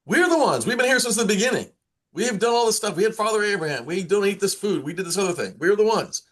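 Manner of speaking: a hint of a group insisting they were first; mock indignant claim of being the chosen ones; indignant believers.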